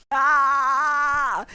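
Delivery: high, loud scream; piercing fangirl squeal; excited scream. Real, not acted. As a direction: shriek at a long-awaited kiss. Reacting to the on-screen kiss.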